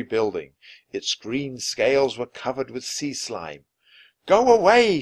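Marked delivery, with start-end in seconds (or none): 4.3-5.0 high, loud shout to scare it off. A narrator's reading. Jack to the sea serpent, shouting. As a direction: shout it away. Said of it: "shouted Jack".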